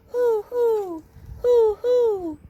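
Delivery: high hooting owl. A narrator's reading. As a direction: hoot like an owl in the dark.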